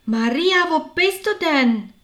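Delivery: high, loud calls through the house; excited calling. A narrator's reading. Martha searching the house for her sister Maria, excited calling. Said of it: "die aufgeregten Rufe".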